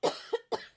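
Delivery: sharp chesty cough. Real, not acted. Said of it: "I'm coughing in the phone".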